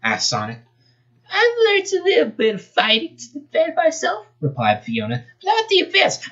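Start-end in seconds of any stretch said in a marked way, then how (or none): 1.3-4.3 put-on much higher, girly voice; squeaky girl voice. Fox girl answers Sonic in a high girly voice. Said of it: "not that voice". 5.4-6.3 put-on much higher, girly voice; squeaky girl voice. Fox girl answers Sonic in a high girly voice. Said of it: "not that voice".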